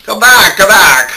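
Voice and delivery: high loud calling after. Acted. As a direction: shout for him to return.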